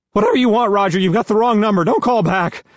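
high, shaky, panicked voice; shaky high-pitched man. A narrator's reading. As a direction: squeak it out, terrified. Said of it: "shaky and high-pitched".

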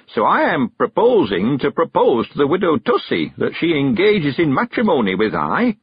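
put-on high old countryman voice; old man sending the girl on an errand, high and eager; Mister Weevil. A narrator's reading. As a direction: lift into a high, chatty old man's voice.

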